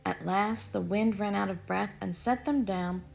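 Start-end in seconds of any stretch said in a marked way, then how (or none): none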